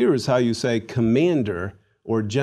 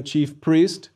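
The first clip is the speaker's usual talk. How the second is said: ordinary talk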